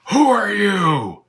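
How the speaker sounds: slow, loud, high yell; lion's yell. Acted. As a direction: bellow the question down at him. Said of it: "the lion yelled at my father".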